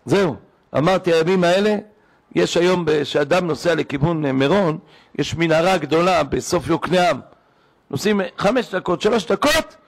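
ordinary talk

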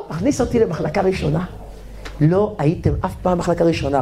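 usual speaking voice

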